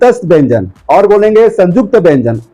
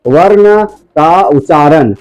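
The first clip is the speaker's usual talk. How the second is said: slow, word by word, for taking notes; slow dictation; slower than usual. Shown as a demonstration. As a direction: dictate it slowly so pupils can write. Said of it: "लिखेंगे".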